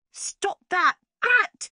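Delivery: very high, loud squealing howl. A narrator's reading. The space gobbler, jumping for floating cupcakes, howling high. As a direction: shriek it like a thwarted villain. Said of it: "he howled".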